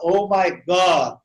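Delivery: "God" cut at the final d, no added vowel; ends on consonant. Shown as a demonstration. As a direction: slowly model "God" with a bare consonant ending. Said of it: "ele acaba no consoante". ("God" acabando na consoante, sem vogal extra)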